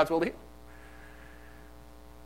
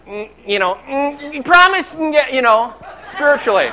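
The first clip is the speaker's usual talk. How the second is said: mock whiny entitled child, higher; spoiled child entitlement; 7 semitones higher, 5 dB louder than usual. Pastor mocking entitled believers, hint of a whiny child. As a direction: mimic a spoiled kid demanding. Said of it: "kind of the spoiled child entitlement".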